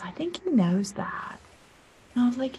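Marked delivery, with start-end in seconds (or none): 0.0-1.4 unvoiced whisper, slow and soft; soft breathy aside; whispered reply. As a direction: hush it like a secret.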